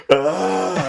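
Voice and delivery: high, loud mock wail; dismayed cry. Kid told to do push-ups, high dismayed cry.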